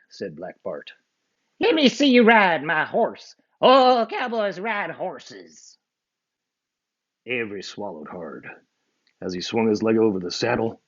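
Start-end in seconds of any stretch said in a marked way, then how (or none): 1.6-5.8 slow, drawled bad guy; outlaw voice. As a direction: lay down the rule.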